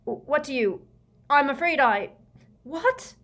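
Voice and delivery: high, broken-off stammer of disbelief; blustering. A narrator's reading. Prime Minister reacts to Fudge's revelations, blustering. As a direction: bluster through false starts, climbing in pitch. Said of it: "blustered the Prime Minister".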